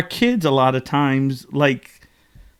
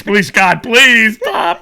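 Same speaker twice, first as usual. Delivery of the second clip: louder, higher begging of a frantic parent; mock desperate pleading; 8 semitones higher, 9 dB louder, much slower than usual. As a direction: beg urgently, imitating a parent at wits' end. Parents begging their screaming child.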